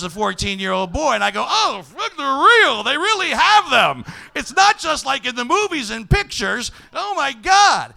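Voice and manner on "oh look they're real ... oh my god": put-on high, loud boyish astonishment; hammed-up wide-eyed wonder; amazed outburst. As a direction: gush loudly in disbelief. Acting a boy's amazed first sight.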